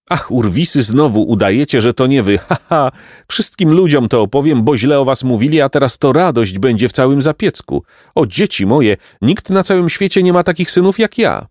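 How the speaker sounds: mother teasing her sons and bragging of them; happy laughing mother. A narrator's reading. Happy mother, half laughing, voice lifted. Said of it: "roześmiała się szczęśliwie".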